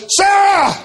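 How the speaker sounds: shouting a name across a street; desperate long-distance shout for a daughter; loud shout. Retold or imitated. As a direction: holler it as loud as you can. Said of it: "as loud as I could".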